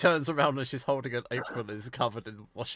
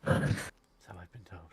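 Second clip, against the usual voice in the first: slow, whispery husky line; 5 semitones lower, 6 dB quieter, much slower than usual. Acted. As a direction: purr it slowly, low and husky. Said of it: "I don't know why I've got a husky voice".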